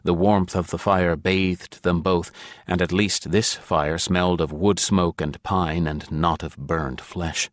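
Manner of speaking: ordinary delivery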